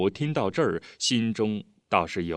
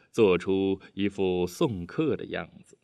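ordinary delivery, nothing marked

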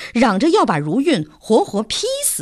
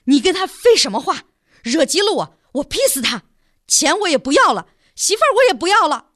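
higher, faster hot-headed rant; impatient outburst; 8 semitones higher, faster than usual. A narrator's reading. Son snaps at his mother, impatient and rushing. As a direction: blurt it out, fed up and threatening. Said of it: "王呆子不耐烦了".

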